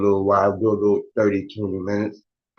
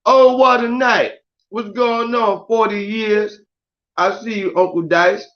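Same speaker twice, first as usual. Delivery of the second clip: high drawn greeting; 10 semitones higher than usual. Real, not acted. Greeting live viewers in a high, stretched call. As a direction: stretch it out up high like a big hello.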